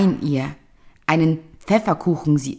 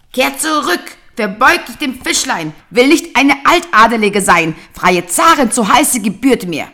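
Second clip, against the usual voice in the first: angry demand; 8 semitones higher, 8 dB louder than usual. Acted. Old wife demanding furiously.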